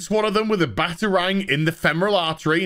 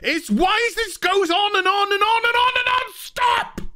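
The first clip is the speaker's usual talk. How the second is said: shrieking Alice; 14 semitones higher than usual. Retold or imitated. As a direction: screech it in frustration. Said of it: "she's just like".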